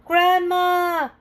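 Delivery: high, loud happy cry of Grandma; joyful shout. A narrator's reading. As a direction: call out to grandma with delight. Pete sees grandma from window.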